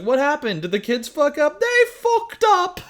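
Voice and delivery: hammed-up shock at the news; panicked adults. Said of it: "it's like".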